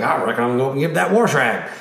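a hint of the Southern Illinois accent of home. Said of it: "when I go back to Mattoon, I start saying".

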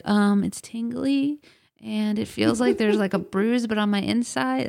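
high, halting, puzzled voice; unsure past self. Retold or imitated. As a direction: grope for words like a confused kid. Her past self, groping for words.

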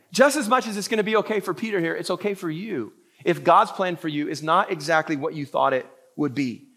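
ordinary talk, nothing marked

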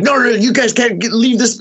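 high, mock yelling attendant; imitating angry attendant. Attendant orders the group out, high mock yell. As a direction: shoo them off like a frantic guard. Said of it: "he got all crazy on us".

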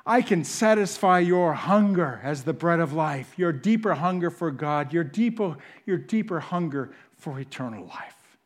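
ordinary talk

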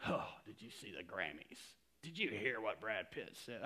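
lightly voiced hushed gossip; mock gossip. Preacher mimicking churchgoers gossiping about celebrities, lightly. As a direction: play a nosy churchgoer.

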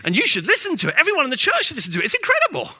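high, loud, gushing imitation of an enthusiastic churchgoer; gushing congregant. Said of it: "they come and see me and say".

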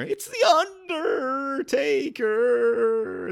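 high, wailing, very slow announcement; high-pitched manager impression, drawn out; old wrestling manager. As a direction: stretch the name into an eerie high wail. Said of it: "in the voice of his old manager".

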